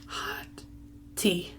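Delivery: barely audible, trailing off; quiet. Real, not acted. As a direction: say it almost under your breath. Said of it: "That was hot".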